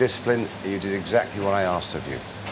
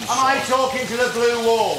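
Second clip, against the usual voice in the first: shouting; 13 semitones higher than usual. Real, not acted. Chef scolding silent team.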